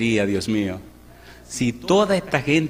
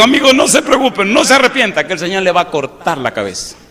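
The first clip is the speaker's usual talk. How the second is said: mock warning; 7 semitones higher, 9 dB louder than usual. Preacher mocking the man.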